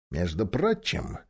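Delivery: plain reading voice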